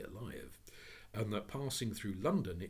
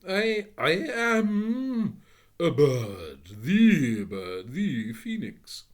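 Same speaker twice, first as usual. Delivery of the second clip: much higher, louder, slow; offended phoenix; 8 semitones higher, 16 dB louder, much slower than usual. Acted. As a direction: insist on its rank. Phoenix insists.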